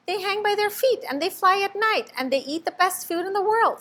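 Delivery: high, loud, rushing; can't wait to share; excited explaining. A narrator's reading. Stellaluna tells the birds about bats, high and thrilled. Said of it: "Stellaluna explained".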